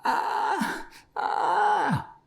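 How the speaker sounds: high, gasping fright; breathless terror. A narrator's reading. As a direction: struggle to get words out. Terrified man cannot say it.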